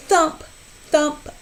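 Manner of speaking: usual speaking voice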